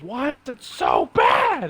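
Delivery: high, drawn-out mock shout; slow, high, hammed-up wail; Cameron freaking out. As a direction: imitate a friend screaming in despair. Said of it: "Cameron freaking out the whole time".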